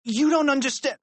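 put-on high, anxious boy's plea; high-pitched flustered insistence; flustered protest. A narrator's reading. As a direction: plead to be heard. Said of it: "Harold stammered".

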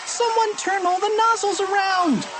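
very high, frantic schoolgirl wail; acted high-pitched alarm; panicked cry. A narrator's reading. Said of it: "she cried".

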